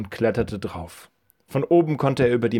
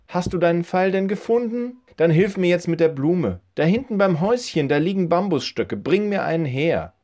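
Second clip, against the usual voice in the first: higher-pitched lady's voice; old woman instructing; 7 semitones higher than usual. Acted. Old woman asks boy to help with flower, higher-pitched voice. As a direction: direct the boy in a raised woman's voice.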